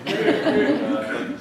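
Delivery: high breathy laugh bursting out; sudden loud laugh; burst of laughter. Real, not acted. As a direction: crack up at your own joke. Laughing at own joke.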